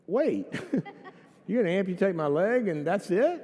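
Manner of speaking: high, disbelieving protest; incredulous patient. Retold or imitated. Patient to surgeon about to amputate his leg, incredulous. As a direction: balk in comic disbelief.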